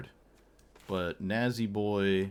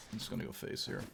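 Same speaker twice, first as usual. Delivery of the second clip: whispered; 3 semitones lower, 11 dB quieter, faster than usual. Real, not acted. Muttered decision.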